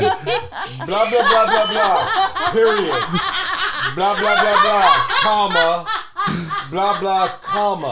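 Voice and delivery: mock Dan's pompous dictation; impression of Dan. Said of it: "this is my impression of Dan".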